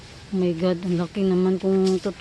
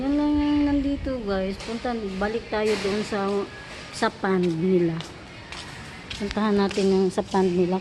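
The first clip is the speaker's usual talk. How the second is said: ordinary delivery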